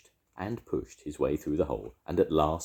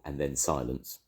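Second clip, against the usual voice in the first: ordinary delivery, nothing marked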